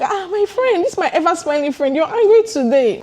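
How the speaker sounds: high-pitched doting friend act; mock-concerned friend. Same friend greeting the upset narrator.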